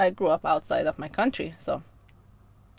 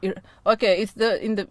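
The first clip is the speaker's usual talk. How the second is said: ordinary delivery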